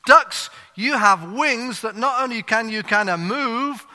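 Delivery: hammed-up pulpit climax; duck preacher. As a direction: build the preaching to a fervent peak. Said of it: "the duck preacher".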